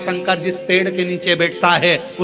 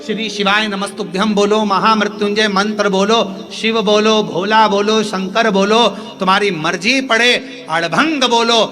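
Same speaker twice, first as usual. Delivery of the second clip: a preacher calling out Shiva's many names, raised and ringing; preaching at full force; 6 semitones higher than usual. Acted. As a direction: proclaim each name of Shiva to the crowd.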